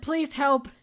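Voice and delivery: hammed-up cry for help; mock desperate plea. Sobbing boss warning an employee about the video game, mock desperate. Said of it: "just starts sobbing".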